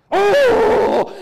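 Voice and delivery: high, loud mock wail; mock fretting oh. Neighbors envying the believer, hammed-up wail. Mocking hand-wringing onlooker.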